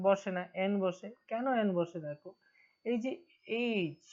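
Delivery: ordinary delivery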